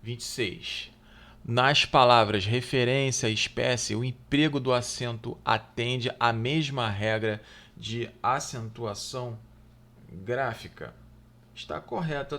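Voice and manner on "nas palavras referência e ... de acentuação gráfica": louder, deliberate read of the test question; reading the exam item. Acted. Teacher reading the exam question aloud to students, deliberately. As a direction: read the question out clearly to the class.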